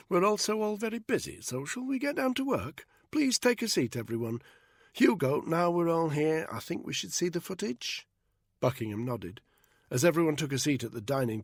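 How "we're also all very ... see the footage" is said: Victoria's voice. A narrator's reading. Victoria voiced, northern, brisk.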